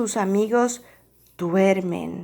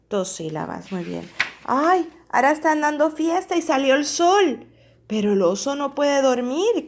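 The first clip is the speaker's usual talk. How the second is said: plain reading voice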